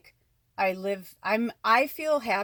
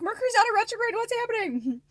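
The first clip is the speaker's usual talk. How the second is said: high, exasperated wail; mock bewildered cry; 14 semitones higher, 3 dB louder than usual. Complaining to viewers about the chaos, high-pitched. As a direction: throw up your hands in disbelief. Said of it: "I'm like".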